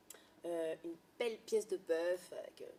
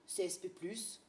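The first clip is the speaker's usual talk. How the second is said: usual speaking voice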